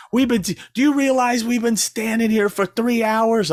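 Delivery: mocking complaining fan. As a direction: gripe about waiting in line.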